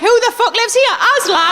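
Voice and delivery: lightly voiced shocked cat; outraged cat. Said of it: "the cat would be like".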